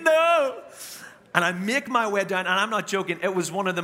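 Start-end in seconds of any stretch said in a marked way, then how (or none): 0.0-0.6 high, loud, drawn-out wail; hammed-up yelp of anguish; mock weeping wail. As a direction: imitate a sister's despairing cry. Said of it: "lets out this whelp of weeping".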